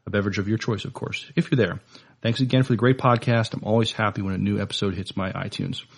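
ordinary delivery

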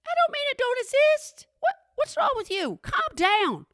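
high, incredulous squeal; exasperated retort. Retold or imitated. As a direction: shoot way up in disbelief. Retort to indignant cashier.

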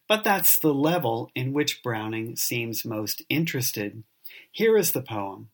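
normal talking voice

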